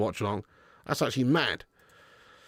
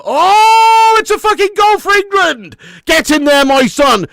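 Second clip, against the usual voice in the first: very high, full-throated screaming cheer; real goal celebration yell; 14 dB louder, slower than usual. Real, not acted. Fan erupting as England score, ecstatic shout.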